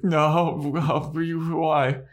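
high-pitched mock refusal; balking Buffy. Buffy refusing a private talk with Spike, high and balking. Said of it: "And Buffy's like".

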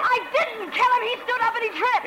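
high, rushed denial; cornered dancer denying the killing, frantic; heated denial. Acted. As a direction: fight back hard.

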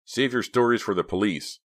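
stern guard's rebuff; insistent. A narrator's reading. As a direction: cut him off firmly. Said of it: "the guard insisted".

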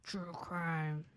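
low, solemn trailer voice; deep voice. Pretend. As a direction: announce it deep and grave. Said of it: "That's me and my deep voice".

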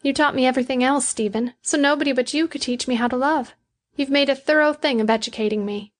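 high-pitched, quick character voice; loving Betty. A narrator's reading. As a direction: voice the woman in love, pitched well up.

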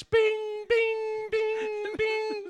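high sung ding of a cabin call button; call light chime. Retold or imitated. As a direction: chime it like a plane's call bell, over and over. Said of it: "You'd hear a bunch of call lights".

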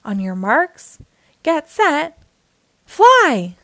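builds to a high shouted fly; starting call. A narrator's reading. The announcer starts the race, building to a shout.

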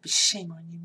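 loud reproach; scolding. Retold or imitated. Bride scolding groom Mike, loud shaming reproach. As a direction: say it out loud to shame him. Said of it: "I said".

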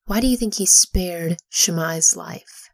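usual speaking voice

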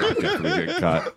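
laughing panic. Retold or imitated. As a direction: rattle it off laughing at the doom. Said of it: "I was like".

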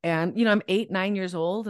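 ordinary talk

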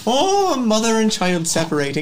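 hammed-up, high-pitched tearful gushing; playfully overdone weepy farewell; tearing up. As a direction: wail sentimentally, pitched right up, in mock emotion. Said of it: "Helga's tearing up".

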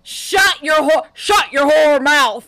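loud, slow, shouted fake fury; mock outrage. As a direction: yell in dramatic disbelief. Mock outrage at not seeing Tangled.

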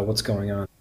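ordinary talk

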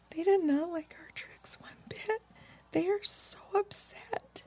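soft, high, breath-only whisper; sad whisper. A narrator's reading. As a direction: whisper it softly and sadly. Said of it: "became very sad suddenly and whispered softly".

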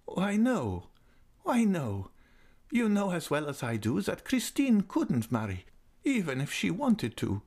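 put-on high, tender old lady's voice; fond old lady. A narrator's reading. Old lady invites Raoul near, voice high and fond. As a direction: coax him close like a loving old aunt.

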